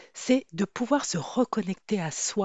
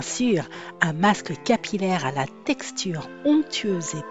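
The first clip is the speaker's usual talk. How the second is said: soothing intro read; 5 dB louder than usual. Acted. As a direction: read it like a relaxing spa ad. Soothing ad-style intro over music.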